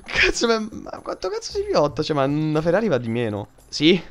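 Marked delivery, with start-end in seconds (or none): 0.0-2.0 very high, squeaking disbelief; astonished. Real, not acted. Gamer amazed by a fast car, pitch jumping high. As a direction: squeal at the speed.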